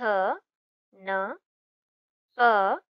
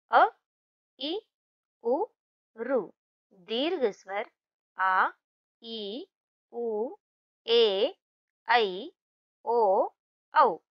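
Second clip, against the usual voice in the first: reciting vowels; much slower than usual. Shown as a demonstration. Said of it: "दोहराकर पढ़िए और समझिए".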